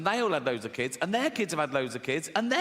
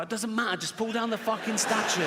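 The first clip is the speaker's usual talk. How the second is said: higher-pitched impatient demand; mock indignant protester; 6 semitones higher than usual. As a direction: play a protester brushing off objections. Acted protester brushing off objection.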